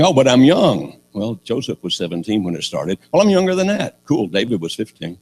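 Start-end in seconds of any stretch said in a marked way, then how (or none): 0.0-1.0 raised voice of a protesting youngster; mildly hammed-up youthful excuse; young objector. Preacher mimics young listeners making excuses, protesting. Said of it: "If you're saying". 3.1-4.0 higher-pitched protesting youngster; young objector. Acted. Preacher voices a younger listener objecting, high protest.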